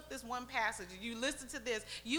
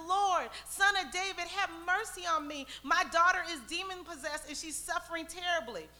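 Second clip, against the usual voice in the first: loud, high-pitched desperate plea; crying out; 5 semitones higher, 5 dB louder than usual. Acted. Canaanite woman begs Jesus for her daughter, crying out. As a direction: call out for mercy, raised and urgent. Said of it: "she starts crying".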